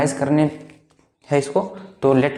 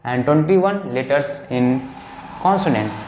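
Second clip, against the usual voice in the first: slow dictation; much slower than usual. Shown as a demonstration. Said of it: "teacher dictates summary".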